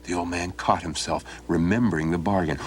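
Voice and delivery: ordinary delivery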